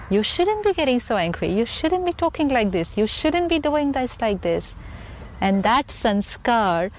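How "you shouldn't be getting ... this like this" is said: higher, nagging reproach, said again and again; nagging scold. Retold or imitated. People around you scolding the angry person, in a higher nagging voice. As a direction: mimic critics lecturing you. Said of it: "everyone sending me this energy".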